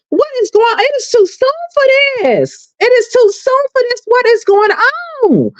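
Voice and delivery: squeaky falsetto of mock disbelief; mock squeal. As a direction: squeal it in fake horror. High-pitched mock dismay, far timbre.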